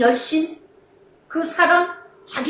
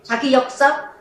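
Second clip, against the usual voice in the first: usual speaking voice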